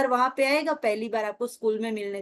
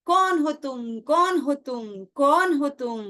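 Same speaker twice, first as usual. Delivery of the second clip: echo; 2 semitones higher, 4 dB louder, slower than usual. Retold or imitated. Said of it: "पहाड़ में से क्या आवाज आई".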